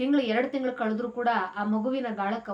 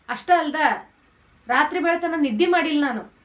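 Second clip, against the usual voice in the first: louder, higher, pouring his heart out; impassioned plea; 4 semitones higher, 4 dB louder than usual. Retold or imitated. Son to his mother about his sleepless struggle, impassioned and louder. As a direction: tell her how hard it was, voice rising.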